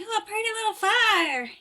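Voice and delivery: high-pitched mock-innocent exclamation; Cotton. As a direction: pitch it way up, like a dim sweetheart. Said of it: "Cotton's like".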